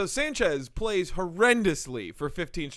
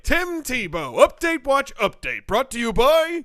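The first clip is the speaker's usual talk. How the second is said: high, loud jingle echo; mock sponsor announcer; 8 semitones higher, 9 dB louder, slower than usual. Co-host echoing the Tim Tebow segment sign-off, mock announcer. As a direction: blast out the title and sponsor lead-in.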